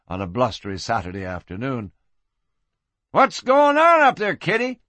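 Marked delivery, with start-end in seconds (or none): 3.1-4.8 high-pitched teasing call; amused cowboy. Acted. Cowboy teases the madam from the street, amused. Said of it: "laughed a twenty-mile cowboy".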